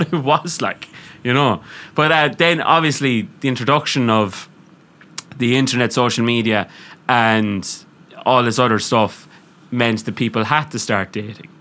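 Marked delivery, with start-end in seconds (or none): none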